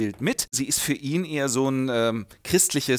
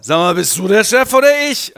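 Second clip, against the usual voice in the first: loud, higher, belligerent challenge; drunken defiance; 6 semitones higher, 10 dB louder than usual. Retold or imitated. Drunk Heinrich defying von Simon, loud and belligerent. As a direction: bark it at the boss.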